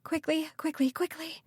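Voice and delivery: high-pitched, urgent repeated plea, held in; anxious muttering. A narrator's reading. Callie frets while wringing her hands, anxious muttering. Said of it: "Callie muttered".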